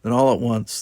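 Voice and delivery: usual speaking voice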